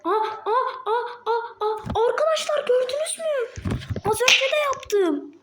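very high, thrilled squeals; excited. Real, not acted. Gamer squealing to friends that he did it again, excited. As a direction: yelp with delight at the trick.

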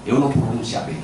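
ordinary delivery

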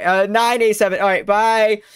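high, loud farewell call, last word stretched; loud sign-off. Real, not acted. Streamer raiding and leaving, loud sign-off. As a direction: call goodbye out to the crowd.

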